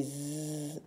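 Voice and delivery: "Z" as a long buzzing zzz; letter sound of Z. Shown as a demonstration. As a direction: hold the buzz of "Z", not the letter name. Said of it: "le son ze".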